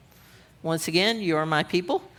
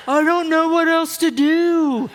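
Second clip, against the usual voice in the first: comically plaintive, pitched up; protesting husband; 7 semitones higher, 4 dB louder than usual. Acted. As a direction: play a hammed-up husband pleading innocence. Voices her husband protesting to her.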